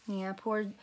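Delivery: ordinary delivery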